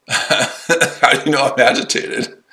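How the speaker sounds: laughing through the question; amused, laugh breaking into the words. Real, not acted. Laughing at a chat accusation.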